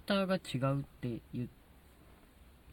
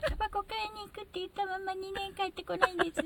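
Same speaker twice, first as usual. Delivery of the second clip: troubled housewife voice; 4 dB quieter than usual. Retold or imitated. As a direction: complain like an abandoned wife. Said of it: "そうそうそう". (嘆く奥さんの声)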